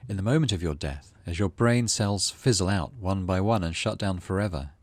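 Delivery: normal talking voice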